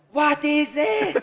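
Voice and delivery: slow, high drawn-out question; exaggerated manager. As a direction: stretch it out in disbelief. Manager demo.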